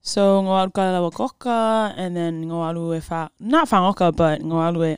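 Samoan-English mix, Samoan accent. Shown as a demonstration. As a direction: answer casually in a Samoan accent. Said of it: "Can you speak a little Samoan for us?".

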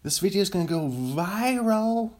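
high, mock-excited exclamation; excited mimicry. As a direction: imitate someone thrilled, pitch climbing high. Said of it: "someone gets really excited and they go".

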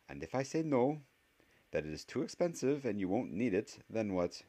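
high-pitched voice of a testing professor; stern witch professor. Acted.

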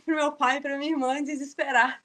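normal talking voice

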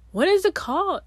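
high, loud frustrated question; exasperated. Real, not acted. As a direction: throw up your hands searching. Frustrated search for word.

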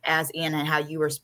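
usual speaking voice

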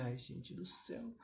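very soft, slow private groan; exasperated aside. Retold or imitated. Trapped listener to himself, quiet exasperation. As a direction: sigh it slowly under your voice.

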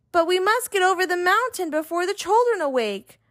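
a hint of worried toys; dolls and toys. A narrator's reading. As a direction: insist as the little dolls. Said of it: "said all the dolls and toys".

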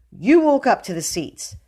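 put-on bossy boy captor; commanding. A narrator's reading. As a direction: order the prisoner about. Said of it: "commanded William".

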